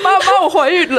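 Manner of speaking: mock confession. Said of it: "对啊".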